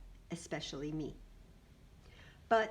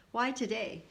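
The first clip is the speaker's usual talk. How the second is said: usual speaking voice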